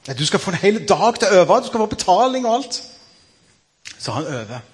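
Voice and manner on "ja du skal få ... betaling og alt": higher, bright selling director's voice; eager zoo director. Acted. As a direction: pitch it up to seal the deal. Director reassures the new gorilla. (ivrig direktør som lokker, høyere stemme)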